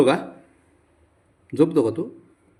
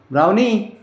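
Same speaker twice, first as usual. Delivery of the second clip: loud, raised call of a name; 7 semitones higher, 8 dB louder, slower than usual. Real, not acted. Owner calling Brownie over, loudly.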